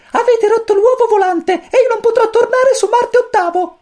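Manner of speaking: very high, squeaky little chick voice; cosmic chick voice. A narrator's reading. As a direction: chirp it like a tiny alien chick. Pulcino cosmico parla. (vocina acutissima da pulcino)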